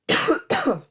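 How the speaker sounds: sudden sharp choking cough; coughing up water. Real, not acted. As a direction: cough hard, water down the wrong pipe. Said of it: "the water went down the wrong pipe".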